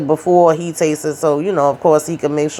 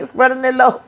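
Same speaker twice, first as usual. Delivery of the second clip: laughing; 6 semitones higher, 3 dB quieter than usual. Real, not acted. Speaker laughs at own gossip.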